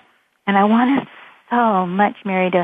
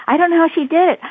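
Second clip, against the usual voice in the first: high-pitched bewildered mock protest; upset, acted out; 9 semitones higher, 4 dB louder than usual. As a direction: imitate a man at his wits' end. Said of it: "he was just so upset".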